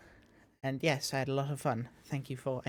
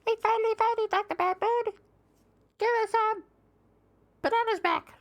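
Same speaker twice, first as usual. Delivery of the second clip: very high, louder cartoon monkey voice; high-pitched voice; 6 dB louder than usual. Monkeys cornering the villain, very high-pitched voice. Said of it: "I was gonna do the high-pitched voice".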